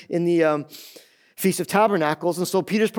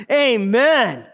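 loud, high-pitched Amen; rousing amen; 6 semitones higher, 5 dB louder than usual. Real, not acted. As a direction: call it out to rally the congregation. Preacher calls for congregation's amen.

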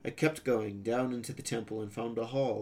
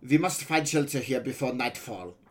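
put-on accented boy pirate voice; 4 dB louder than usual. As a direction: voice a young foreign pirate, pitched up.

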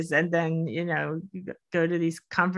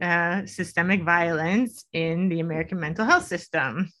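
normal talking voice

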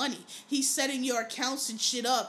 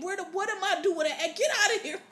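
mock angry dad; 7 semitones higher than usual. Acting out dad dismissing the seller.

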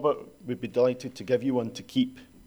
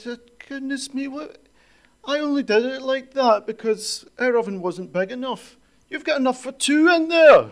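amused mum; 12 semitones higher, 6 dB louder than usual. Retold or imitated. Mum explaining oven to daughter.

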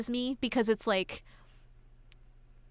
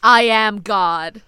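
much louder and slow, a mock divine proclamation; booming God voice; 12 dB louder, much slower than usual. Joking that the writer is God, booming. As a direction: proclaim it like an almighty voice.

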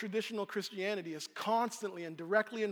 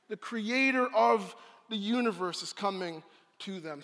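usual speaking voice

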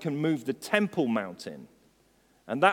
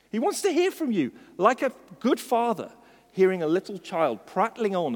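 high, loud preaching that keeps building; impassioned preaching; 8 semitones higher, 5 dB louder than usual. Acted. Preacher urging congregation to think about the King, fervent preaching. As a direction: build to a fervent, raised-pitch peak.

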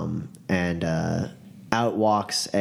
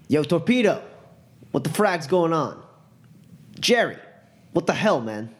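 high, raised call to a partner; shouting out; 9 semitones higher than usual. Acted. Gunman calling to his partners, high indignant shout. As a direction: call out in annoyed disbelief. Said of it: "he shouts out".

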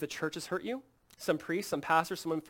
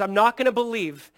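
loud, higher, upset refusal; upset Thomas; 5 semitones higher, 10 dB louder than usual. Retold or imitated. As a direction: refuse loudly, imitating an upset doubter. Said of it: "Thomas is just so upset".

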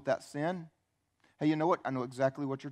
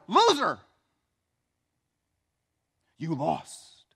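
mocking jeer; 2 semitones higher, 8 dB louder, much slower than usual. Mockers to Jesus on the cross, loud jeer. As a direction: shout it as a scornful heckler.

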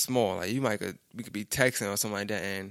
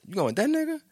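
incredulous question; 13 semitones higher, 3 dB louder, faster than usual. Retold or imitated. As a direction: pitch leaps way up in retold disbelief. Retold disbelief at friend's choice.

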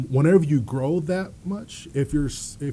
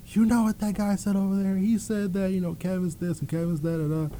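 a hint of mom's higher voice; lightly voiced mom; 7 semitones higher, 4 dB quieter, faster than usual. Mom tells her son what the scout said, lightly voiced. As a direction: retell it as mom, pitched a bit higher.

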